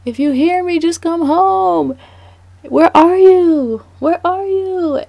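high, loud desperate calling; yelling a name out a car window; calling out for the dog. Retold or imitated. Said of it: "I'm screaming his name out the window".

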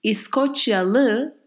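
İskoçyalı said slowly with a rising end; slow Turkish word. Shown as a demonstration. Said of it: "So let's say".

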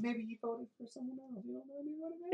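mock innocent. Teasing mock soft high voice.

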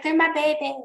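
high, cooing voice for his babies; mock-doting dad; doting parent. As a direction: coo it like a proud parent. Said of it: "It's like".

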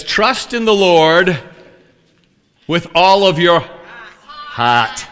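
slow, drawn-out New England Bible verse; loud, hammed-up New England reading; New England accent. Shown as a demonstration. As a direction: put on a New England "heart" without the r. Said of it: "I've never said it like that".

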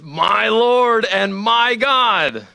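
slow, high, awed cry; awed exclamation. Retold or imitated. Thomas sees the risen Jesus, slow awed cry. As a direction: let it swell in wonder. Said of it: "Thomas exclaims".

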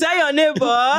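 high, slow preacher call with a laugh. Pretend. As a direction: call it out like a pastor. Mimicking pastor call to neighbor.

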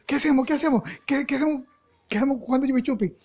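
high, flustered repeated questions; acted panic. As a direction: panic comically, caught holding the loot. Boys panic with stolen goods. (pánico actuado, voz aguda)

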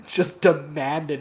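usual speaking voice

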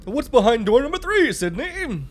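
high, loud gloating taunt; mock taunting killer. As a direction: shout the game-show taunt.

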